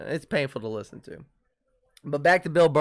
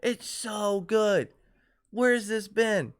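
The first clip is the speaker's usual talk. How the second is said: drawn-out, higher-pitched gushing; mock raving fan; 5 semitones higher, slower than usual. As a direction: imitate fans raving, stretching the words. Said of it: "is fucking raving about this show".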